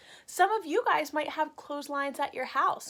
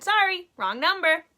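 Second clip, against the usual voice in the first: brisk, higher brush-off; Missus McNosh; 5 semitones higher, 5 dB louder, faster than usual. Acted. Teacher voicing Missus McNosh, a brisk higher brush-off. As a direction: toss it off and hang up.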